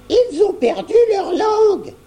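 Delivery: put-on high teasing old lady voice; grandma poking fun gently; grandmother. A narrator's reading. Grand-mère teases the silent children, high old voice. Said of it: "dit grand-mère".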